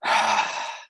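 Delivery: a long breathy sigh of release. Retold or imitated. At the pub after a hard day, a relieved sigh. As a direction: let the tension out in one sigh. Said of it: "I just went".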